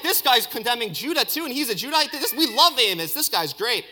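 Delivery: high, loud, gushing fandom; acted cheering crowd, delighted with the prophet; excited crowd shout. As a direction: rave like a thrilled supporter. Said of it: "Israelites would have shouted".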